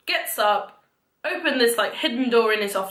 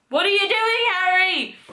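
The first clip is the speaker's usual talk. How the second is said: higher-pitched, exaggerated telling-off; mock scolding; 7 semitones higher than usual. Retelling Harry's quarrel with Albus, mock scolding. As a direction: put on an indignant, high-voiced complaint.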